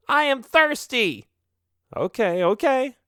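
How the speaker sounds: high bat voice. Acted.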